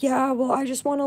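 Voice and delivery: mocking girl's voice. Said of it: "It's like".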